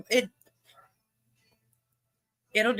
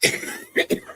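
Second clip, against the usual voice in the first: high, strained wheezing cough; real coughing fit. Real, not acted. Smoker coughing fit on stream.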